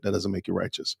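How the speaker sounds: hushed repeat, almost no voice; whisper. Acted. Commentator repeating the rebuke to Michelle, whispered. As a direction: lean in and breathe it as a pointed whisper.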